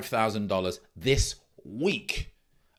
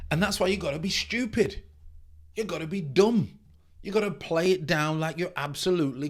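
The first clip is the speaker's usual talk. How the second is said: louder, higher emphatic push; 5 semitones higher, 3 dB louder than usual. Real, not acted. Coach telling viewers to play dumb, emphatic and loud. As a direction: punch "stupid" and "dumb" loud. Passionate urging to simplify.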